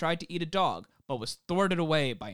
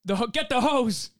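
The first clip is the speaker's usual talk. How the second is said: panicked yell; 8 semitones higher, 5 dB louder than usual. Pretend. Acting out panicked townsfolk.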